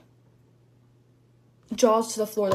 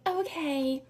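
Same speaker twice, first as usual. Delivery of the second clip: soft, high mock-sweet okay; small, innocent phone voice; meek caller; 5 semitones higher, 6 dB quieter than usual. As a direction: imitate a suddenly polite customer. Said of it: "Where was that energy when you were yelling".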